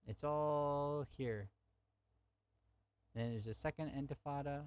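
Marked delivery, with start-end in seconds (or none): 0.1-1.5 slow emphatic. Real, not acted. Slow third repeat.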